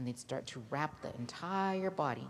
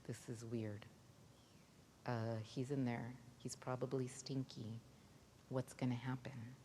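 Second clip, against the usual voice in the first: softer, lower, half-whispered unease; hushed wonder; 4 semitones lower, 7 dB quieter than usual. Acted. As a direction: murmur it like a nervous crowd. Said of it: "looking at each other like".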